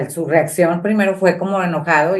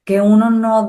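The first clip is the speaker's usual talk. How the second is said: higher, slow emphatic "Mentira"; indignant denial; 6 semitones higher, slower than usual. Retold or imitated. Daughter angrily rejecting her father's excuse, indignant. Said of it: "no es cierto".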